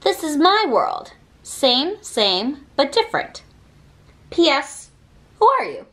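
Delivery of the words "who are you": ordinary delivery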